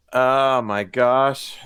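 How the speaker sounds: slow, drawn-out oh my gosh; surprised. Real, not acted. Hearing a colleague's baby is seven months, drawn-out surprise. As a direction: stretch it out in real amazement.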